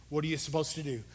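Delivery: usual speaking voice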